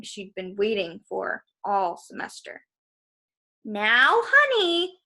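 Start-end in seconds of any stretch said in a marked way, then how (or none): 3.6-5.0 high, loud, sugary-threatening honey; sweet on top, threat beneath; menacing teacher. A narrator's reading. Missus Dodds turning on Percy, sickly sweet menace.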